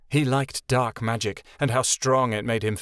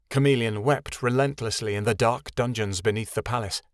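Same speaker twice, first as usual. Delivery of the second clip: ordinary delivery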